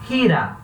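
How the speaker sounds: gira said as hira, breathy h; Spanish g as h. Shown as a demonstration. Teacher demonstrating Spanish g before i. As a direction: gira with a raspy h at the start. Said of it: "vai ter o som do R de rato".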